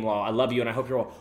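ordinary delivery

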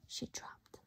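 barely audible whisper. A narrator's reading. Narrating the fall in a tiny whisper. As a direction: whisper the drop.

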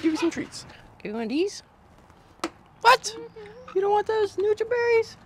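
high sing-song pet talk. Real, not acted. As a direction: coo at the bird sweetly. Cooing to pet bird.

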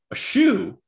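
high, loud, questioning blurt; imitating his delivery. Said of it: "it was the way he said it".

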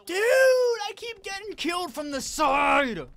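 high, loud, slow whining wail; frustrated wail. Real, not acted. Keeps getting killed in game.

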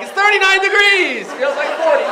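high, loud, delighted yell about the weather; mock excited shout. Underdressed guy cheering the cold spring day, mock excited. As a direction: cheer it like it's summer.

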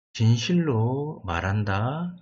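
ordinary talk, nothing marked

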